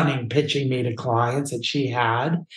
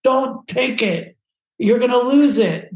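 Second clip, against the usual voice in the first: high, urgent voices in the head; panicked inner voices; 10 semitones higher, 3 dB louder than usual. Retold or imitated. Inner voices warning not to hand over the book, panicked. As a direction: plead in a much higher, alarmed pitch.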